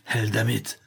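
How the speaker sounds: ordinary delivery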